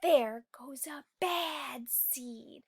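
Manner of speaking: slow whisper under the breath; mumbled whisper. Acted. Other seeds muttering as the bad seed passes, whispered. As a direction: mutter it so he can't hear. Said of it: "Mumble's like a whisper under your breath".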